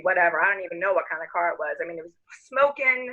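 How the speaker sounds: ordinary talk, nothing marked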